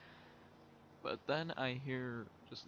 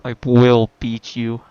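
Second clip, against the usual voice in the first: sudden, very loud, slow mom warning; mock parental threat; 26 dB louder, slower than usual. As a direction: blast it out slowly as an imitated threatening mother. Mother threatening child, retold, very loud.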